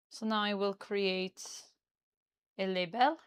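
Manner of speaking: put-on Parisian-style French accent. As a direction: speak English as a Parisian would, with a French accent. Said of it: "but this is my French accent".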